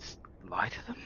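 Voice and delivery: softly whispered question. Real, not acted. Player whispering to fellow player, softly asking. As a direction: hush it like a conspiratorial aside.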